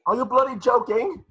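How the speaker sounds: a panicky bloody-joking protest; Neville, incredulous. Acted. Neville's alarmed reply.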